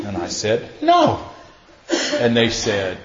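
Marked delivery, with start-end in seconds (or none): 0.8-1.2 tiny high-pitched no; squeaked denial. Retold or imitated. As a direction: pop it out far higher than usual. Acts his terse denial.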